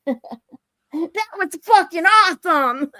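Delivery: high, slow, drawn-out delight; thrilled exclamation. Retold or imitated. Getting off the roller coaster, gushing with slow excitement. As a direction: savour every word, buzzing from the ride. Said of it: "you know what I said?".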